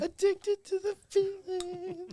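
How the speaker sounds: mock dramatic echo. Teasing the segue.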